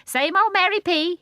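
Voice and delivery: high, loud bird voice; starling teasing Mary Poppins, high; cheeky starling. A narrator's reading. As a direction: chirp it cockily.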